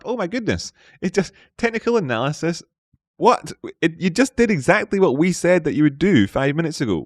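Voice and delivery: high-pitched, incredulous amazement; mock astonishment. Streamer to the market that obeyed his prediction, mock astonishment. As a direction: marvel at it in a high voice.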